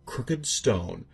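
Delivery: plain reading voice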